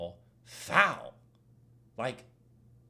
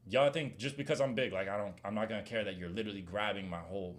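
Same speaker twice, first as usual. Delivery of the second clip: normal talking voice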